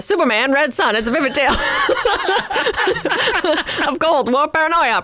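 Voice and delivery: laughing 1930s radio announcer; hammed-up old radio narration; old-timey radio voice. Shown as a demonstration. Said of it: "a nineteen thirties old-timey radio voice".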